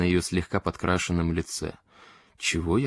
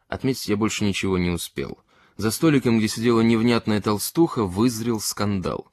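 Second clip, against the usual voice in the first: ordinary delivery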